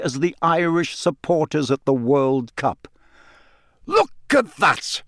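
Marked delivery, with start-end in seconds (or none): 3.9-5.0 mild high-pitched commentator yell; excited yelling. A narrator's reading. Bagman hailing the champion from the stands, yelling. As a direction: call it out high, slowly, word by word. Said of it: "Bagman was yelling".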